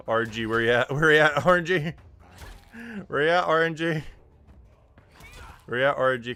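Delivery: loud, urgent pleading for luck; pleading shout. Real, not acted. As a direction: yell at the dice gods. Begging luck mid-fight.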